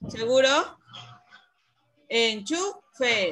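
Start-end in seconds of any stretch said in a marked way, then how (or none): 2.1-3.3 "Enchufe" broken up, CHU held and stressed; stronger syllable demo. Shown as a demonstration. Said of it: "¿Cuál suena más fuerte?".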